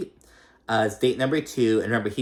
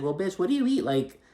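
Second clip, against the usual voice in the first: incredulous; 9 semitones higher, faster than usual. Retold or imitated. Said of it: "it's like".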